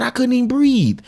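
normal talking voice

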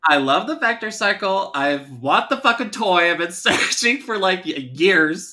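high, loud fan enthusiasm; gushing excitement. Real, not acted. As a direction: gush with real longing, laughing partway. Gushing about a toy they want.

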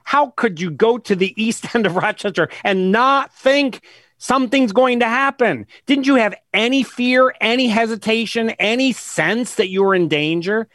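exasperated disbelief. Real, not acted. Exasperated rhetorical questions at victims.

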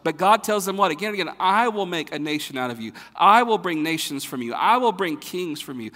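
loud, ringing preacher's voice; full-force preaching. Acted. Pastor to his congregation on God's promises, loud preaching. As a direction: preach it out with rising fire.

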